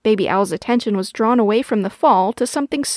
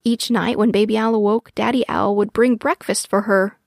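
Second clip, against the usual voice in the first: ordinary delivery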